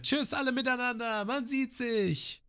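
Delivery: hoch und sehr langsam gerufen; Namis Abschiedsruf. Shown as a demonstration. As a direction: ruf es gedehnt übers Wasser. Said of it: "So ist besser". („Tschüss“ hoch und gedehnt wie Nami)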